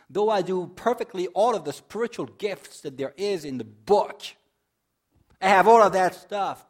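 preaching crescendo; building scripture read. Real, not acted. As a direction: swell each line until it rings out. Reading Corinthians, building to climax.

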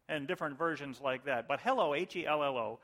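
ordinary delivery

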